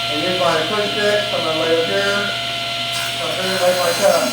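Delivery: loud over the roar of the saw; shouting over table saw. Real, not acted. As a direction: raise the voice above machine noise. Talking over the running table saw.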